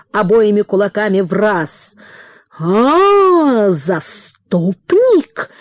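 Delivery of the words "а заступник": higher cry, then a drawn-out, very slow sneer on "заступник"; sneering father. A narrator's reading. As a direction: stretch "заступник" out with scorn. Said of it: "и захрипел".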